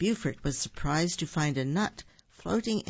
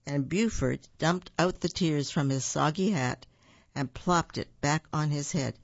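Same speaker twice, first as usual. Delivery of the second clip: ordinary delivery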